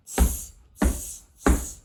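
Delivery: cheeky monkeys tutting back hard; monkey tsk-tsk. A narrator's reading. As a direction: monkeys copying the stamping peddler with tuts. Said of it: "and said".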